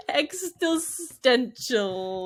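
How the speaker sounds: "Existential" dragged out as eks-stil-STENT-chul; laboured mispronunciation. Real, not acted. Host attempting the word, very slow garbled "Existential". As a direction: pick very slowly through "Existential" and garble its middle. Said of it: "very hard time pronouncing".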